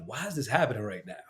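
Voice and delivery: hushed, voiceless self-questioning; retold distressed whisper. Man recalling his emotional moment on the cruise, whispered. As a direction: whisper it as a bewildered inner thought.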